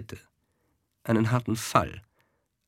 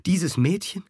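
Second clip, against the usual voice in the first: horrified cry; 7 semitones higher than usual. A narrator's reading. The Scuderie aghast at the charge against Madelon. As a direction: protest in disbelief, voice rising. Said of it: "schrie die Scuderie".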